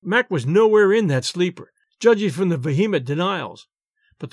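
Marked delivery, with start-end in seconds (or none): none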